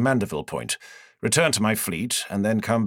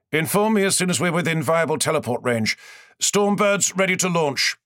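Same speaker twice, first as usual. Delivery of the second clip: firm, raised voice of a commander giving orders; commanding lord; 7 semitones higher than usual. A narrator's reading. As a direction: give the order like a lord on his ship.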